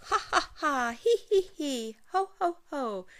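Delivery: high, loud helpless laughing; tickled laughter. Acted. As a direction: burst out laughing under the tickle.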